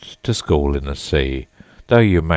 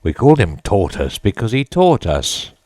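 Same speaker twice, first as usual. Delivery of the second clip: angrily; 4 semitones higher, 5 dB louder than usual. A narrator's reading. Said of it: "said the Mock Turtle angrily".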